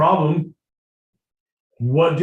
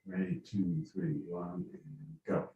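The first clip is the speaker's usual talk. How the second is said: normal talking voice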